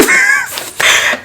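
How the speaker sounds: high squeal of laughter; real laugh. Real, not acted. Vlogger amused by the boyfriend's reply, high laughing. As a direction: crack up after the punchline.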